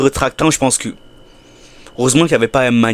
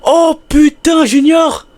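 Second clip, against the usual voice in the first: shocked scream; 5 dB louder, much slower than usual. Real, not acted.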